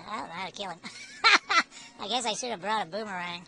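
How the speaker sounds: ordinary delivery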